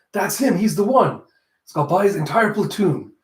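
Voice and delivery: put-on eager buyer choosing the boy; eager pick. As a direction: point him out excitedly. Lemire eagerly picks the boy.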